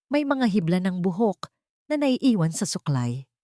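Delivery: usual speaking voice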